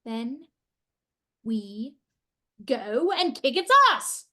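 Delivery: low then bursting loud; rising to an angry shout; furious. Acted. Furious, she strides out.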